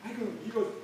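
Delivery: ordinary talk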